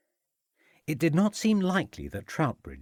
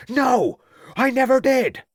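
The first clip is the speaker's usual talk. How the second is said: high, loud shout; 8 dB louder than usual. A narrator's reading. Farmer shouting his denial at the detective. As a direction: yell the denial. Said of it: "shouted Troutbridge".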